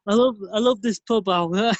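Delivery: mock northern Gary Agincourt. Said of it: "I'm Gary Agincourt".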